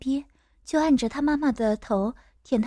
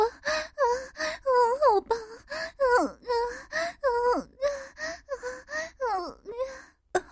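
very high, acted moaning; moaning mother character; 11 semitones higher than usual. Mother calling to the narrator in high moans. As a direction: break short phrases with high cries.

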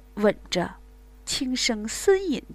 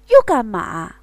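loud, annoyed snap; man interrupted, loudly annoyed; irritated bark; 12 dB louder than usual. Acted. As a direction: throw it out, fed up and loud. Man annoyed at being stopped.

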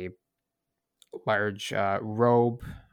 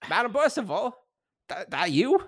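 high, rough guard voice in a bad Irish accent; hammed-up Irish sentry, pitched well up; 11 semitones higher than usual. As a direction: call out like a suspicious Irish guard. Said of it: "I'm going with it".

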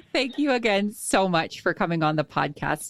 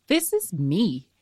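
excited; 12 semitones higher, 4 dB louder than usual. Retold or imitated. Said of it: "I was like".

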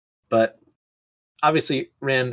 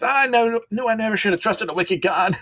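high lament; 9 semitones higher than usual. Acted. Leaving poor Seira behind.

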